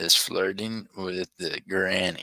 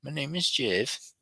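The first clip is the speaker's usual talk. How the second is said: "My name is Jeff" in a higher, softer voice, falling on "is"; higher mock quote; 6 semitones higher, 4 dB quieter than usual. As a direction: go up and soften for the silly take.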